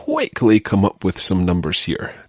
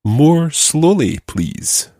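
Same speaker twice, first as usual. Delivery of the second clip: higher, slow, stretched out "More slowly"; demonstrating slow speech; 7 semitones higher, much slower than usual. Shown as a demonstration. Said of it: "let's try another phrase".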